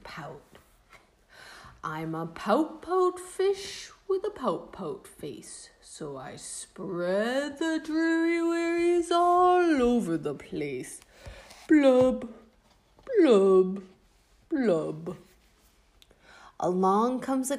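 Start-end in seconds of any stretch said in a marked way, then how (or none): 1.8-11.0 slow, glum fish singsong; mopey fish chant; gloomy pout fish. Acted. Pout fish refrain, gloomy character voice. 11.7-15.2 booming blub blub blub; gloomy fish blubbing; loud fish blubs. Acted. Fish's blub refrain.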